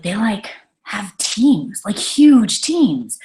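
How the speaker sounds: slow, hushed whisper; awed whisper. Retold or imitated. Telling her husband about huge teams, in an awed slow whisper. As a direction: breathe it out slowly, amazed at the size.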